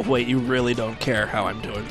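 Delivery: usual speaking voice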